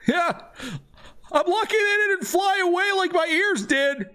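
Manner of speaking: very high, squealing character voice; excited mage. Acted. Transformed mage greeting returning adventurers, high excited squeal. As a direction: cry it out far above your own pitch. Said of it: "And he's like".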